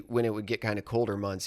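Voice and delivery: usual speaking voice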